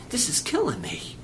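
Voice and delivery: whispered, hushed confession; anguished whisper. Retold or imitated. Jesus confiding in his disciples, anguished whisper. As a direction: drop to a pained whisper.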